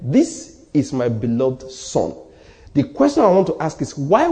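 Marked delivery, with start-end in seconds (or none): 0.0-2.1 "This is my beloved son" very slow and low, word by word; clear, deliberate. Retold or imitated. Preacher showing that God said it clearly, slow and deliberate. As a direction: spell out "This is my beloved son" slowly and distinctly. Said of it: "he said clearly".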